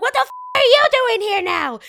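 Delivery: high, very loud outraged yell; hysterical shout. Retold or imitated. Niece to uncle at the airport, hysterical shouting. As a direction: shout it in hammed-up disbelief.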